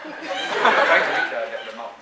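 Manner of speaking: slow, laughing through the words; chuckling at his own failed mind read. Real, not acted. Mentalist to the audience, joking while laughing.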